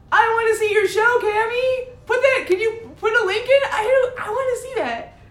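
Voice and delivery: high-pitched excited plea; high, giggly enthusiasm; eager request. Real, not acted. Eager request to see show.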